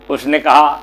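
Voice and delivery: plain reading voice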